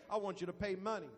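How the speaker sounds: lightly voiced God, low and soft; quick, quiet divine instruction. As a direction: give God a low, understated murmur. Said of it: "you hear the voice of God saying".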